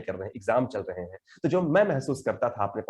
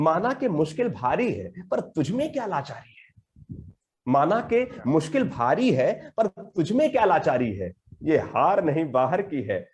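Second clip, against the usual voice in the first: slow, rhythmic poetry reading; poem recitation; 5 semitones higher, slower than usual. Acted. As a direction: recite each line with measured weight.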